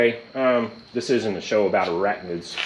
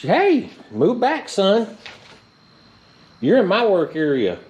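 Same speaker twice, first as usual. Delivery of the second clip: high-pitched warning; 10 semitones higher, 4 dB louder, slower than usual. Real, not acted. Spider crawls into work area.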